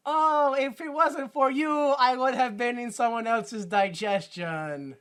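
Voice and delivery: put-on high, slow mouse-kid voice; hammed-up little mouse. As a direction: draw it out high like a cartoon mouse child. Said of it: "what the little mouse kid says".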